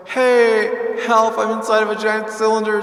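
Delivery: normal talking voice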